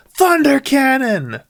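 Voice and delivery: high, loud, slow battle cry; attack shout. Acted. The pirate girl fires her attack, battle cry. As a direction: call out the special move.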